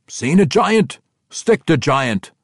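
ordinary delivery

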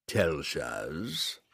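a low summoning demon voice; demon's bellow. Acted. Demon lord summons his servant, low demon call. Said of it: "the demon bellowed".

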